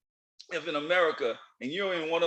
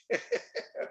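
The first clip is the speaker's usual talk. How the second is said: high wheezy laugh; 7 dB quieter than usual. Real, not acted. Laughing at own mock excuse.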